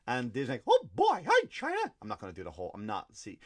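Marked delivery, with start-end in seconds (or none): 0.7-1.8 very high squeaky cartoon mouse. Disney answering China's phone call, squeaky mouse voice. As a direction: squeak it in a loud falsetto. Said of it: "I could do the mouse voice".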